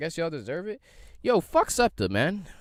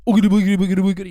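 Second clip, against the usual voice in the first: loud, high, rapid-fire boogeyman gibberish; hammed-up spook, racing through the syllables; mock spooky ghost; 9 semitones higher, 12 dB louder, much faster than usual. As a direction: ridicule them with a cartoon ghost chant. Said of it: "y'all wearing these dumbass hoods. Y'all like".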